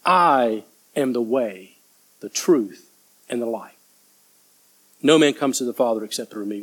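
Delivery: low, measured scripture quote with pauses; solemn declaration. Acted. Preacher quoting Jesus to Thomas, solemn. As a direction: let each phrase settle, low and grave.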